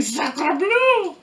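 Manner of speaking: outraged French pastry chef. Retold or imitated. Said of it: "some baker out there pulling his hair out saying".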